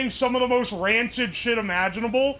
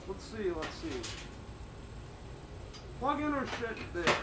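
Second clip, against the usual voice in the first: muffled under a helmet, much softer; 11 dB quieter than usual. Real, not acted. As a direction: speak from inside a helmet. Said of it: "which is why I sound muffled".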